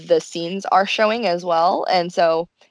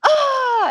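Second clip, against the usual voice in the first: much higher, loud mock wail; frustrated shriek; 4 dB louder than usual. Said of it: "they get so frustrated".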